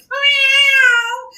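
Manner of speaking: high, loud yowling meow; sudden piercing cat yowl. Acted. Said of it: "She meowed a big meow".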